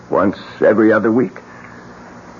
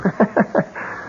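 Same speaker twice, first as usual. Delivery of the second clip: airy laugh; 9 semitones higher, 6 dB quieter than usual. Acted. Amused by blunt reply.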